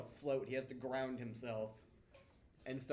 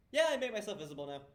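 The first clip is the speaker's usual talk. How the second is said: quick, louder, higher smug wizard voice; 9 semitones higher, 11 dB louder, faster than usual. Acted. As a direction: toss it off like a show-off mage. Magic Man voiced by GM.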